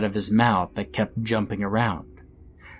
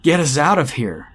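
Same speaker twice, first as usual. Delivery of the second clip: loud, higher-pitched plea; desperate shout; 5 semitones higher, 5 dB louder than usual. A narrator's reading. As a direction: shout for rescue, urgently. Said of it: "shouted the second kid again".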